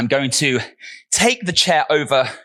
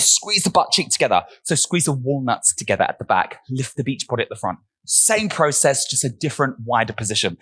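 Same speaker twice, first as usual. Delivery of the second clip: normal talking voice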